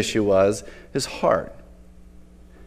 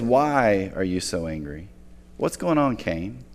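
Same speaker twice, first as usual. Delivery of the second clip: low, softened imitation of God; gentle questioning; 5 semitones lower, 7 dB quieter, slower than usual. God questions angry Cain, gentle questioning. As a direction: ask quietly and slowly, as God.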